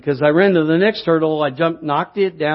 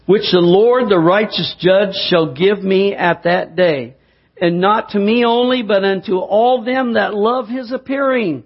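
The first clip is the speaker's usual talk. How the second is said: loud, slowed scripture proclamation; full-force preaching; 3 semitones higher, 3 dB louder than usual. Acted. Preacher reading scripture to the congregation, full-force preaching. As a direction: proclaim each phrase to the back row.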